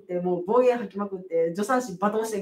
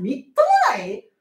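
high, sharp mock outrage; indignant echo; 5 dB louder than usual. Midwife scoffing at a mother's worry, indignant echo. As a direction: throw the word back in disbelief. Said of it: "何を、ケツ抜かす".

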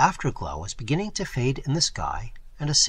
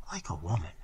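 softer, barely spoken; under her breath; 7 semitones lower, 8 dB quieter, faster than usual. A narrator's reading. Girl repeating the traveller's careless words, under her breath. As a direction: murmur it to yourself. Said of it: "she repeated under her breath".

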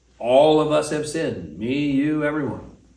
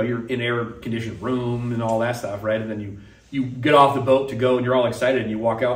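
usual speaking voice

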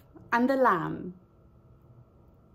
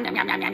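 nom nom eating; 3 semitones lower, much faster than usual. Acted. Wolf eats the lamb.

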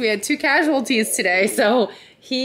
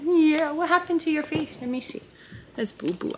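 soft, cooing mom voice; 7 semitones higher, 4 dB quieter than usual. Real, not acted. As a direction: gently fuss over a boo-boo. Checking baby's hurt face.

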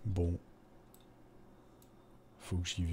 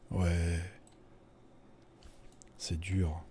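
weary, sparse reply with a long pause; tired; much slower than usual. Acted. Drifter answers a stranger noting his fatigue, tired. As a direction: answer as if worn out. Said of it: "vous êtes fatigué".

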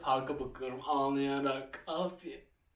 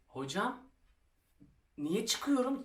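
higher protest to the teacher; a pupil objecting after being sent out, higher; 8 semitones higher, slower than usual. Retold or imitated. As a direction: push back to the teacher, pitched up.

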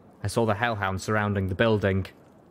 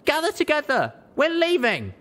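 high shouted orders; rally everyone before the roof goes; high, loud call to gather; urgent shout; 3 dB louder than usual. Acted. Building collapsing, calling everyone.

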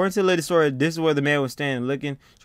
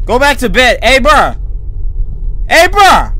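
high, loud frightened shouting; frantic, much higher and louder; panicked yelling; 11 semitones higher, 12 dB louder than usual. Real, not acted. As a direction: yell at the character in a panic. Panicked player yells at game.

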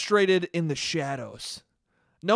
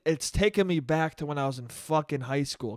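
ordinary talk

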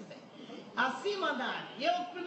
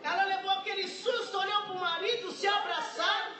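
raised, fervent testimony voice; fervent preaching; 5 semitones higher than usual. Acted. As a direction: preach it out to the congregation.

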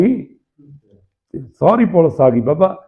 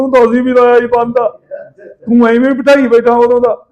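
higher, louder scolding shout; hearty loud trucker banter; 7 semitones higher, 5 dB louder than usual. Retold or imitated. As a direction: yell it at a friend. Friend scolding during police raid.

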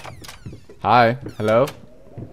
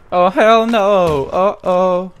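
scared refusal; 6 semitones higher, 6 dB louder, much slower than usual. Real, not acted. Uh, hell no.

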